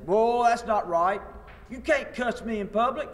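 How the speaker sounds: mock an offended Southern man; indignant Southern accent. Southern man arguing back with the teacher, mock-indignant Southern accent. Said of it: "in this particular accent".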